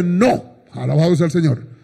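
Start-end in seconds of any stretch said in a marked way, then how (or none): none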